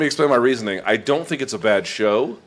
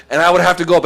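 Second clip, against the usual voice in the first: normal talking voice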